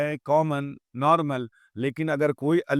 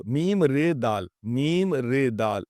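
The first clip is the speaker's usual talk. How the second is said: letter by letter, slow; slow classroom spelling; spelled out slowly; slower than usual. Shown as a demonstration. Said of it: "مرد، مرد،".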